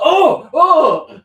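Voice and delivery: loud, high mock startle; startled yelp. Retelling drunk Frank noticing his friend, startled yelp. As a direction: cry out as if caught unawares.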